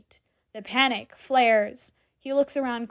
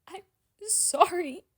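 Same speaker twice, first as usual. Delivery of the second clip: high, tearful sorry; between tears; 9 semitones higher than usual. A narrator's reading. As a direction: sob the word out. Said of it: "repeats in between tears".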